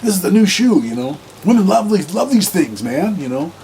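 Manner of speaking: high, loud boastful show-off; bragging cop. Retold or imitated. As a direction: sell the shoes like a proud peacock. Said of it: "the shoe and he goes".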